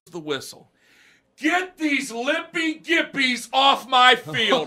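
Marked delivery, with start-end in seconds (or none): 1.4-4.7 slow, high, loud coach yell laughing through it. Retold or imitated. Coach roaring at injured players to get off field, angry yell. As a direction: roar them off the field.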